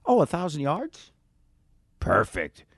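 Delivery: lightly mock-pleased voice; a hint of a satisfied birder; imitating Audubon Society.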